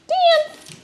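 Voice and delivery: squeaky bright order to the dog; high excited command. Real, not acted. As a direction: call it up high and playful. Telling dog to dance.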